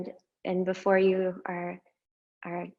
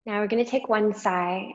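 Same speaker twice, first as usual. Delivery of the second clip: usual speaking voice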